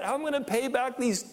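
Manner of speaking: high-pitched mock worry; fretting debtor. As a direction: lightly act a debtor fretting. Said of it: "They were in debt".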